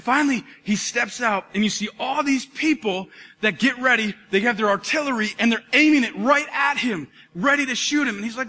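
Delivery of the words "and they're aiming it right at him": ordinary talk, nothing marked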